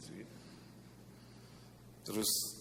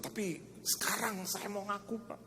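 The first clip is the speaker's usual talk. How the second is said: higher, pleading voice of the businessman; retold man's plea; 10 semitones higher than usual. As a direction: voice him higher, close to tears. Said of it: "Terus dia bilang". (suara memohon lebih tinggi)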